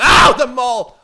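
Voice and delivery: loud, high yelp; sudden high shout of alarm; startled yell. Real, not acted. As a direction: cry out at a sudden hit. Attacked by a mole.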